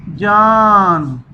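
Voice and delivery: जान drawn out very slowly as one word; slow blend. Shown as a demonstration. Teacher modelling the joined word for pupils, slowly. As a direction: lean on the long आ of जान before the न. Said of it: "इकट्ठा पढ़ना है".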